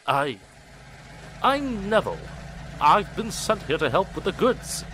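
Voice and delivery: shy new engine introducing himself; shy newcomer. Acted. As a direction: stammer it out nervously.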